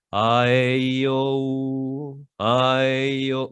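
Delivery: low register exercise; low vowel vocalise. Shown as a demonstration. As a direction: drop the tone for each vowel. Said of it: "Tono abajo".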